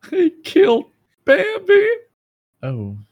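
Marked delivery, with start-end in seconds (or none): 0.1-1.9 shrieking falsetto, much higher and louder; mock shock. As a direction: gasp it in high mock outrage. Mock outrage about Bambi.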